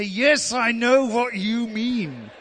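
high, loud, slow retort; mock exasperated answer to a friend; exasperated reply. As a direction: drag it out, fed up with the phrase. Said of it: "I just wanted to say".

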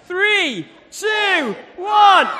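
rising pitch, shouting the last number; building countdown. Real, not acted. Host counting down before hitting Eddie on the back, builds to a shout. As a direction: count down to a big hit.

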